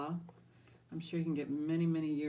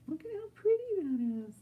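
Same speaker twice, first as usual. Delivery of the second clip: much higher, fast cooing; squeaky admiring voice; 13 semitones higher, faster than usual. Real, not acted. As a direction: coo over how pretty it is.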